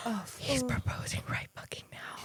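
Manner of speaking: breathy, hushed retold realisation; stunned whisper. Girlfriend realising the proposal is coming, stunned whisper. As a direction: whisper it to yourself in shock.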